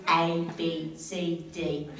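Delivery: loud, broad Australian letters; hammed-up Australian alphabet lesson; exaggerated Australian accent. Shown as a demonstration. Mother teaching baby the ABCs, exaggerated Australian accent. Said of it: "doing the A B Cs like this".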